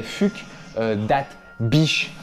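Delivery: « Fugdat » avec un u français, puis « Bish » détaché; détache « Fugdat » et « Bish », sans accent anglais; prononciation très française. Shown as a demonstration. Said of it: "je vais pas le dire avec l'accent anglais". (« Fugdat… Bish » découpé et lu à la française)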